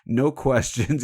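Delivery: ordinary talk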